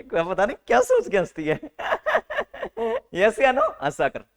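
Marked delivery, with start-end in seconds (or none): 1.6-3.1 high mock giggle mimicking a girl who laughs to herself; a sly private laugh, hammed up; imitated giggle. Teacher mimics Gudiya's secret laugh, high mock giggle. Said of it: "अंदर ही अंदर हंसती है".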